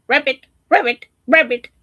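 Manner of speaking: loud, high croaking frog voice. Acted. Frog in the pond, a loud croak. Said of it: "That's how the frogs speak".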